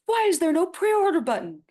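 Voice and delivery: loud, very high-pitched mock outrage; mock angry email. Voicing an angry email to the publisher, mock angry. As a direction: yell it like an irate customer, hammed up. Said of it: "a very angry email going".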